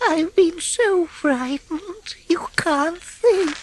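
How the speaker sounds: high, slow, trembling little voice; almost whispered fear; frightened. A narrator's reading. Mole telling the rat how scared he was, frightened. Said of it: "he cried".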